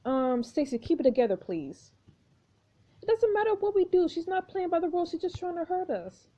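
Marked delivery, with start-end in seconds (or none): 3.0-6.3 panicked, high. Acted. Stacy panicking.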